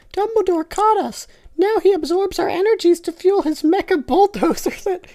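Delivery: very high squeaky Teletubby voice; earnest toy-character squeak. Acted. Teletubby telling Snape the backstory, very high and squeaky.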